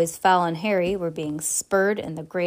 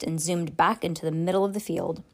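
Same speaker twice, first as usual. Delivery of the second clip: plain reading voice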